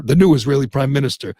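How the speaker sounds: ordinary talk, nothing marked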